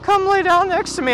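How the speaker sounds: high-pitched nagging complaint, imitating; imitated wifely scolding; mad wife. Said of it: "she gets mad at me, she's like".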